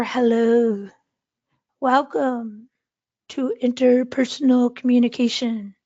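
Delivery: slow greeting with long pauses; very slow pace. Shown as a demonstration. Teacher demonstrating a different pace to class, very slow. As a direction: draw each word out, pausing between. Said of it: "those are different paces".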